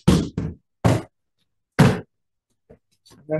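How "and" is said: ordinary talk, nothing marked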